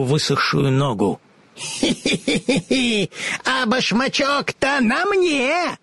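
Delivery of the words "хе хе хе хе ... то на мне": high, slow cackling witch voice; teasing witch. A narrator's reading. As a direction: gloat like a wicked old witch. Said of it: "дразнила Бастинда девочку".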